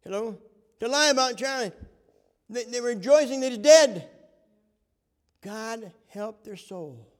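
loud, high preacher's cry; preaching at full force. Acted. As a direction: rail at the liars from the pulpit.

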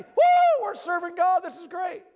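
hammed-up people cheering with joy; joyful whoop. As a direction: cheer like overjoyed worshippers. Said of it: "they're just happy".

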